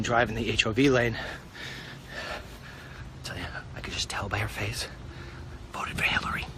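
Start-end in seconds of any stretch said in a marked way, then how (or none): 3.2-6.5 hushed gossipy whisper. Real, not acted. Whispering a sly aside to viewers. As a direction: lean in and whisper the dig.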